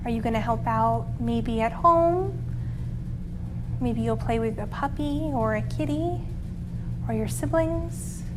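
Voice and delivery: soft, calm meditation voice; calming guided relaxation. Acted. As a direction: guide the kids into rest gently.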